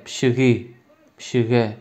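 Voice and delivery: ordinary talk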